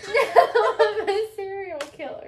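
high, loud "serial killer"; loud emphatic repeat. Real, not acted. Repeating the phrase to guessing partner, loud and high. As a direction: belt it out for the guesser.

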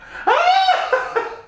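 high-pitched excited squeal; loud high squeal. Acted. Celebrating the job news with flatmate, high squeal.

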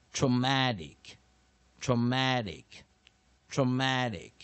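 "traumatic" loud, with the middle "mat" stressed; model pronunciation. Shown as a demonstration. Teacher modelling traumatic for students, loud stress on "mat". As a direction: repeat "traumatic" emphatically, pausing after each.

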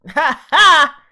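loud, high burst of laughter. Real, not acted. Viewer laughing out loud at the show. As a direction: crack up at the scene.

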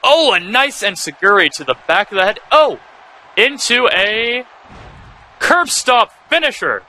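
rising, hyped call of the finisher; excited commentator. Real, not acted. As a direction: build the hype into the big move. Live wrestling play-by-play call.

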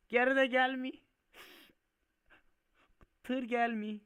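high, loud wail; loud dismayed cry. Real, not acted. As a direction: cry out that it won't budge. Tır geri gelmiyor, bağırıyor. (tiz sesle feryat ederek)

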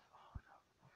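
breathy hushed denial; whispered no. Real, not acted. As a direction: whisper it in disbelief. Sees a plane crash.

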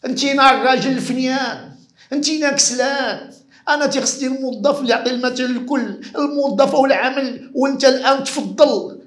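high, loud scolding boss; boss berating. Acted. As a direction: tear into a lazy employee. Boss berates lazy employee.

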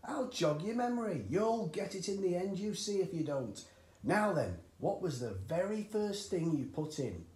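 put-on jolly the boy along; coaxing dad. A narrator's reading. Cranky coaxes George.

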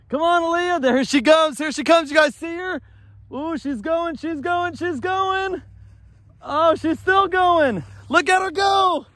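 high-pitched running commentary on a sled ride; excited cheering. Real, not acted. Cheering on child's sled run.

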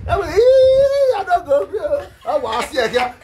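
high, loud, drawn-out mock cry; mock wail. Taunting someone about Libya, hammed-up wailing.

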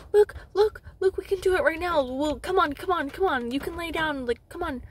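high, urgent imitation of a staffer; eager coaxing. Staffer urging patient to do it now, eager coaxing. Man retold urging her to stay.

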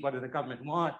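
ordinary delivery

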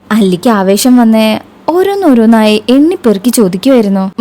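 plain reading voice

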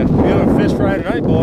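loud, high triumphant call; excited announcement. Real, not acted. Angler telling his friends about the fish fry, excited.

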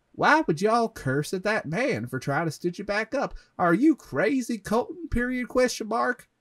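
high-pitched, hammed-up indignant scolding; mock fury. A narrator's reading. Annie scolding Colton for cursing at the man, mock fury. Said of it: "began to get furious with me".

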